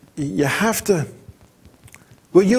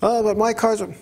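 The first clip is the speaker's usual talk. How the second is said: a higher mock-whiny excuse; imitating Michael; 7 semitones higher than usual. Announcer mimics Michael Waltrip defending his car, a light whine. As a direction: lampoon the driver's protest. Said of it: "you know Michael Waltrip, you listen to him announcing".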